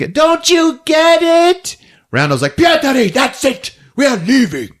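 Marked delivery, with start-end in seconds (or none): 0.1-1.8 high, loud, stretched-out pleading shout; mock desperate yell. Pietri shouts when no one takes it seriously, desperate. 2.6-4.7 high, strained scolding shout; exasperated mock yell. Rauno orders Pietri to leave with him, exasperated yell.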